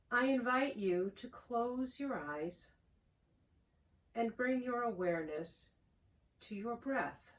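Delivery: calm, unhurried voice with long pauses; soothing prayer-meditation delivery, phrase by phrase; guided meditation. Real, not acted. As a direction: guide them gently into the breath, softening as you go. Leads a guided breathing meditation.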